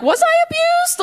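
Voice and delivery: high, sudden squeak of doubt; high squeaky wonder. Retold or imitated. Self asking about childhood, in a high squeak. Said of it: "you're like".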